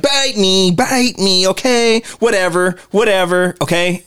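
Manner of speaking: high, repetitive, dismissive comeback; imitated Leo Getz. Private eye answering an insult with his tagline, dismissive. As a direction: toss back retorts in a high mimicked voice. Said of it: "Leo Getz is like".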